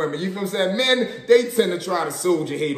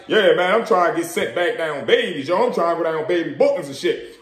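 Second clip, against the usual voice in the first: bluffing tough guy; 2 semitones lower than usual. Retold or imitated. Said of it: "Whole time they bluffing".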